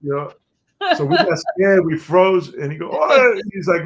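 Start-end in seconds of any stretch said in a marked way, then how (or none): 2.9-3.4 higher shouted Caco bark; Caco's voice. Retold or imitated. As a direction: call out as Caco. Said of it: "'Cause he talked like this".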